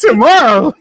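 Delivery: high, loud disbelieving echo; incredulous. Real, not acted. Mocking a friend's slow watching plan, loud and incredulous. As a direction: shoot the word back in disbelief.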